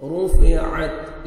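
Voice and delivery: "रूफे आत" drawn out, ain from the throat; correct ain articulation. Shown as a demonstration. Teacher showing the ain, slow "रूफे आत". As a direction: slowly chant "रूफे आत" with a distinct ain. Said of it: "आइन को अपने सही मखरज से".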